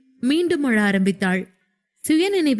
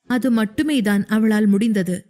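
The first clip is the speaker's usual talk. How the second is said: usual speaking voice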